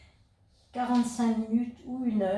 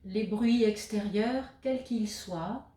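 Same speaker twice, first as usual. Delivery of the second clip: guided meditation; 3 dB quieter than usual. Acted. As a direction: guide the listener into stillness, leaving space between phrases. Guides a yoga nidra session. (voix posée de méditation guidée, avec de longues pauses)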